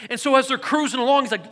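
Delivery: ordinary delivery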